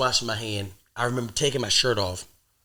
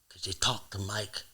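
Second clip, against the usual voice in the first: Godfather quote; 5 dB quieter than usual. Retold or imitated. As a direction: plead quietly like a doomed mobster. Quoting The Godfather.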